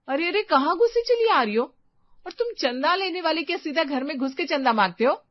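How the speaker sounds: high, loud scolding of an intruder; indignant shout. Acted. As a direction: shout at the stranger to stop. Stranger walks into the house. (घर में घुसी औरत पर ऊँची आवाज़ में चिल्लाना)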